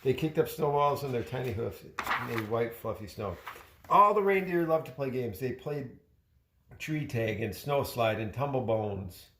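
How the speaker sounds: plain reading voice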